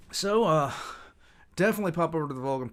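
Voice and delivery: hyped outro. Real, not acted. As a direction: pump up the plug. Energetic outro plug.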